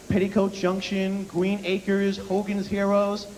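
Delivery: ordinary delivery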